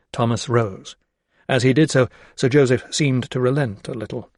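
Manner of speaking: ordinary delivery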